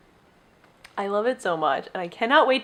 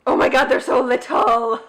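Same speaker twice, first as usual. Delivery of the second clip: excited; 3 semitones higher, 5 dB louder than usual. Real, not acted. Unboxing tiny books, overjoyed.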